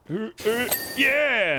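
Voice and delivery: high, loud triumphant yeah; excited yeah. Real, not acted. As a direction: cheer as the jump lands. Jump shot landed.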